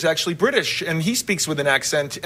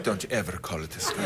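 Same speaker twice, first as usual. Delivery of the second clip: very low, hushed mock threat; quiet mock menace; dark menacing imitation; 12 semitones lower, 11 dB quieter, faster than usual. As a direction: imitate someone gone suddenly dark, low and soft. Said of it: "You just got dark".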